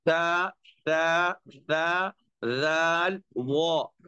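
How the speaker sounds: ता राल वो, slow, from the tongue tip; careful ता राल वो; letter sounds demo. Shown as a demonstration. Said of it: "ये जो है बहुत आसान है". (ता, राल, वो धीरे)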